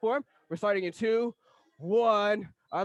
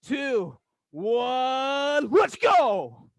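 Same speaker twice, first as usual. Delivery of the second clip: calling out a countdown loudly; countdown shout; 4 dB louder, much slower than usual. Real, not acted. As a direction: pump up the class, count them in.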